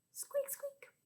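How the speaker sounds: tiny, high, hushed squeak; mouse squeak. Pretend. Teacher voicing a mouse for children, tiny high squeaks. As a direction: squeak softly like a little mouse. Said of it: "Hear that funny sound?".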